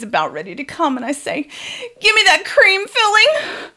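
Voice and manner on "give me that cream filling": loud, very high comic scream; retold scream. Woman to lover at the climax, retold as a high scream. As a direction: scream the demand, pitch shooting way up. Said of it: "you've screamed the contents of a Cadbury commercial".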